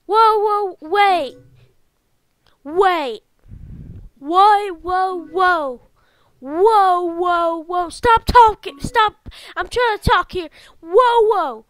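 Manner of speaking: loud, alarmed protest; shocked outburst. Real, not acted. Player stopping the game in shock, loudly protesting. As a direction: shout over the game to halt it.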